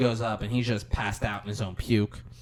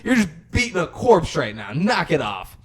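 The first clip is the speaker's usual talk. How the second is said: mock yell; 6 semitones higher, 8 dB louder than usual. Still yelling at the sister.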